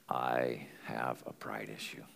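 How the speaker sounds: hushed, unvoiced whisper, slowed down; whispered confession. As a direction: let it slip out as a whispered admission. Confessing pride after a pause.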